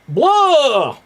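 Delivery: loud, high-pitched vampire cry; a big, held vampire "blah"; hammed-up vampire outburst; Dracula.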